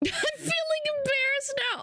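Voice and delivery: very high squeaky mimicry; hammed-up high embarrassed voice; mock embarrassed. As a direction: squeal it as the bashful viewer. Voicing the viewer's embarrassed message.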